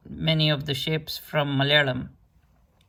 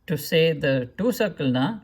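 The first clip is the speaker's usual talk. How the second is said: normal talking voice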